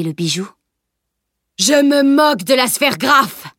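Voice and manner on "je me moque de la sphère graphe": high shouted creature snarl; monster shouting. Acted. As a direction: yell it out, a monster's outburst. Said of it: "le tzlich avait crié".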